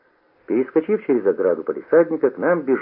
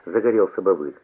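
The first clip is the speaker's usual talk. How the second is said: ordinary delivery, nothing marked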